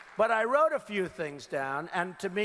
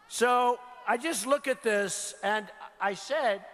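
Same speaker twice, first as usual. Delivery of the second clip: ordinary talk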